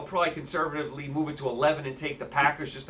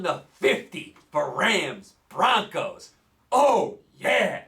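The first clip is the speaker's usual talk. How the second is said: mock excitement; 6 semitones higher, slower than usual. Sarcastic hype.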